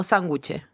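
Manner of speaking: sándwich said "san-GU-che", low; sándwich as "sanguche". Shown as a demonstration. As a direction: give sándwich the colloquial G and final E. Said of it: "Y tú escribís sandwich".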